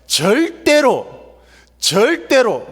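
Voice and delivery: 절대로 swooping high and drawn out, both times; vehement insistence. Acted. Peter protesting to Jesus that he won't deny him, vehement. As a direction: stretch 절대로 into a high, emphatic vow.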